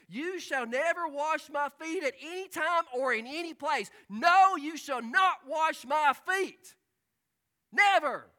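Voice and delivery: loud, slow, forceful refusal; emphatic objection. Acted. Preacher voicing Peter's loud emphatic refusal of the washing. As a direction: plant your feet and refuse outright. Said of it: "an emphatic objection by Peter".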